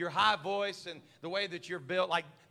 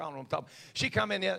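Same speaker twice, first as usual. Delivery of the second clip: ordinary talk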